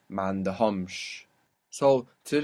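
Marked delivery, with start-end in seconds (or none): 0.1-1.2 "Mandahomsh" slowly, weight on HOMSH; stress on last syllable. Shown as a demonstration. Teacher shows two consonants at the end, stressing HOMSH in Mandahomsh. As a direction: pull the accent onto the end of "Mandahomsh". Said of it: "pull the accent onto the end".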